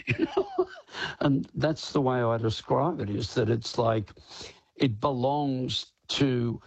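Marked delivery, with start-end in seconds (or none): 0.0-0.7 laughing. Real, not acted. Laughs while finishing the line.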